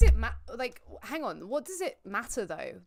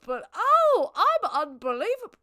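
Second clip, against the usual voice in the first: much higher, loud cry of disbelief; exasperated outburst; 11 semitones higher, 11 dB louder than usual. Real, not acted. Streamer blowing a move in the game, crying out high and loud. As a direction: blurt it out at yourself, half laughing.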